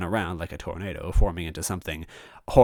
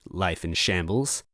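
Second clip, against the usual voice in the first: plain reading voice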